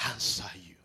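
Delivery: sudden whispered answer. Acted. Preacher answering the congregation's call, whispered.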